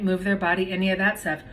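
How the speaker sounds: ordinary delivery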